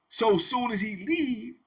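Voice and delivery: normal talking voice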